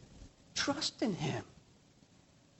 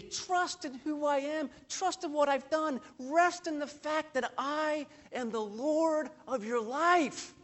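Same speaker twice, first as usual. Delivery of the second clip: gentle reassurance; 6 semitones higher, 5 dB quieter than usual. Acted. The Lord speaks to worried hearts, soft and tender. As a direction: invite them to rest, tenderly.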